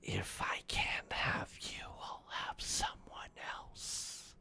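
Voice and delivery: slow, sinister whisper; creepy whisper. Acted. Villain threatening the player from the dark, creepy whisper. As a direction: whisper it slowly, like a lurking threat. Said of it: "you hear a quiet creak and it goes".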